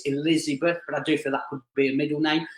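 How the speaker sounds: usual speaking voice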